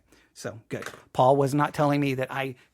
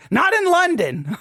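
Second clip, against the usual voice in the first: much higher, louder playful correction; comic emphatic correction; 11 semitones higher, 5 dB louder than usual. Real, not acted. Host mocks his own geography mix-up, comically emphatic. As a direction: leap up in pitch, about to laugh.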